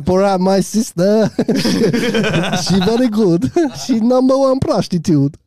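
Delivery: Borat impression.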